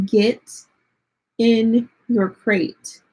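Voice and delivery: very slow, each word spaced; deliberate, drawn-out order; slow firm command. Retold or imitated. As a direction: spell it out to the dog. Final firm command to dog.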